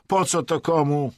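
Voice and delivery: high, loud dismissive question. A narrator's reading. Vadim asks who needs Latin, dismissively. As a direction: toss it off as worthless. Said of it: "rzucił lekceważąco".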